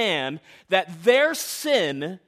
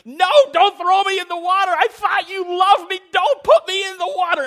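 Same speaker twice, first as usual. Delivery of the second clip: put-on high, loud panicked child pleading; child screaming; 11 semitones higher, 9 dB louder than usual. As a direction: scream it like a terrified kid being dragged to the pool. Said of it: "And I'd be screaming at her".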